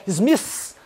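"Smith" said as "smiss". Shown as a demonstration. English teacher showing a mistake, "Smith" as drawn-out "smiss". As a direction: end "Smith" on a long hissed S. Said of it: "não é Smith".

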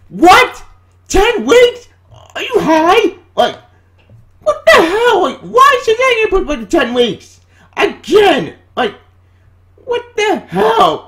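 furious fan shouting what the hell; outraged disbelief. Real, not acted. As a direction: scream your disbelief. Outraged at Shazam delay.

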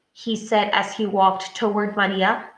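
usual speaking voice

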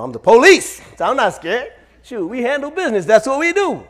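loud, puffed-up cop swagger; mock bravado. Frightened officer tells himself he's not scared, mock bravado. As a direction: boast loudly to cover up fear. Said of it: "trying to be like".

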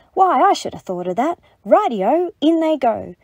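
high, loud, eager Dingo; gleeful storybook go-ahead; delighted agreement. A narrator's reading. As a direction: pipe up as if thrilled by the idea.

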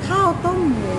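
ข้าวต้มหมู said high with a falling end; Thai pronunciation. Shown as a demonstration. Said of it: "Thai word we call".

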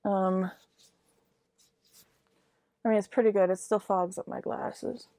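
muffled behind cloth mask; speaking with covered mouth; through a face mask. Real, not acted.

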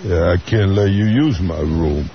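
low, slow Rocky-like drawl; Johnny Depp voice. Retold or imitated. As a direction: do Depp as a Rocky Balboa type. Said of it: "Johnny Depp walks in and goes".

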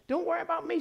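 high, soft mimicked mother's voice; lightly reassuring, high-pitched imitation; imitating mother. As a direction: pitch it up and soften it, as mom would. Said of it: "She was talking like this".

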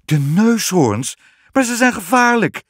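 high, loud, aghast exclamation; shocked queen calling out; alarmed cry. A narrator's reading. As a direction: cry out in disbelief, stretching the first words. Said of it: "riep de koningin".

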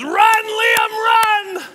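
wife screaming at a runner; imitated full-volume, high-pitched yell; loud cheering. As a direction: shriek it high, urging him on. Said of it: "she's cheering so loud".

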